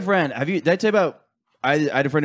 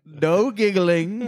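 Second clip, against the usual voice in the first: slow, much higher mock teacher voice; stern teacher voice; 9 semitones higher, much slower than usual. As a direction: drag it out like a prissy teacher warning the class. Imitating a strict teacher.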